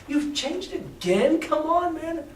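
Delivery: teasing disbelief, stretched out; mock exasperated Jim. Reader replaying Jim's jab at Silver, mock incredulous. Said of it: "It's like".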